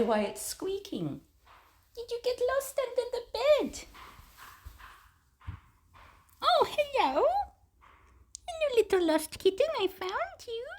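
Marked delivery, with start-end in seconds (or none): 1.9-10.7 very high cooing voice. Real, not acted. Cat sitter finding a lost kitten, high baby talk. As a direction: coo sky-high to a tiny pet.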